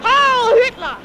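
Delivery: put-on German accent. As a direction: call it out in an imitated German accent. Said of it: "he spoke with a German accent".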